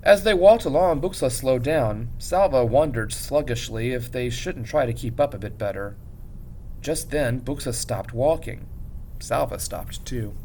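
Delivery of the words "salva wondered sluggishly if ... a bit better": ordinary delivery, nothing marked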